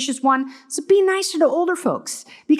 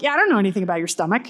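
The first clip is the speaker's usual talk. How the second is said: dismissive doctor; 6 semitones lower, much faster than usual. Retold or imitated. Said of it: "And they're like".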